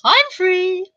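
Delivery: high sing-out comic catchphrase; imitated camp sitcom call. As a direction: trill it out high and drawn out. Quoting Are You Being Served catchphrase.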